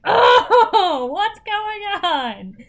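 high, loud, drawn-out surprise; mock surprised gasp. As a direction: feign shock, stretching it slowly. Mock innocent reaction.

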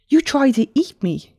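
high, loud outburst of accusation; indignant interruption. A narrator's reading. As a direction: cut in with pitch jumping right up. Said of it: "Vanis interrupted".

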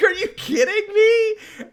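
very high, slow laughing shout; laughing exasperation. Retold or imitated. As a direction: cry out half laughing. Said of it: "So I'm like".

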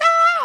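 quick demonstrated scream; high-pitched shriek. Shown as a demonstration. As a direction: let out one sharp shriek. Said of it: "like a, a high-pitched shriek".

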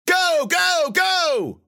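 high, loud urgent yell. A narrator's reading. Rufus yelling at Aiko over the engine, loud. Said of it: "Rufus yelled".

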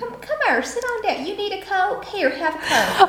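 high, coaxing Southern vampire voice; high-pitched friendly Southern vampire; sweet Southern vampire. Pretend. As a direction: coax the victim with Southern charm. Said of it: "You're like".

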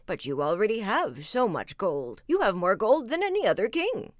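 a stern wizard cautioning the greedy king. Acted. Wizard's reply in bedtime tale.

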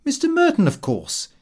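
mildly indignant, starting high and dropping; a touch of affronted surprise on the name; rather indignant. A narrator's reading. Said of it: "I said rather indignantly".

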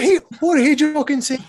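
high-pitched mock outrage; offended Irish listeners. Host joins in as Irish listeners, mock-indignant. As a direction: play an affronted Irish local. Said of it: "all of the Irish people just went".

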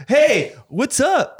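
high, slow, drawn-out hey what's up; mock bro greeting. To us at a show, a high slow mock greeting. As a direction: parody a pushy rapper saying hi. Mocking pushy rappers at a show.

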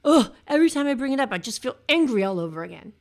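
mock a survivor blowing up over an old grievance; quick, loud, fed-up imitation; imitated angry outburst. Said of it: "Have you heard yourself say that".

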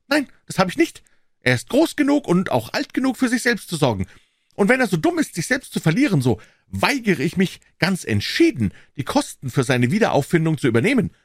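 put-on high, loud, sharp old voice; indignant old man. A narrator's reading. Old man confronting Holmes over the papers, high and indignant.

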